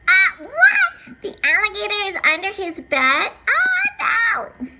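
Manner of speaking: high loud disbelief into laughing cry; shocked squeal. Acted. Hearing the alligator is back, squealing laughing shock. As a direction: squeal in alarm.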